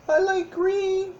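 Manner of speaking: put-on high, slow little kid voice; squeaky puppet child. Teacher voices Timmy puppet.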